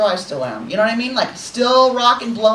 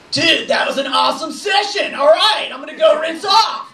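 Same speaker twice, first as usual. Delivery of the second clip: mock dude enthusiasm; 7 semitones higher than usual. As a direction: play a hearty gym bro. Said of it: "I'm kind of like".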